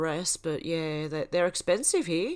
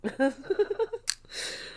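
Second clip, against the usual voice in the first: helpless laugh; 13 semitones higher than usual. Real, not acted. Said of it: "tears coming out of my eyes".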